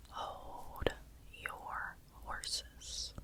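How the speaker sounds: "Hold your horses" stretched out very slow; slowly drawn-out soft whisper; slow drawl. Shown as a demonstration. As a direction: drag it out slowly, unhurried. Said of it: "Why are you such in a rush".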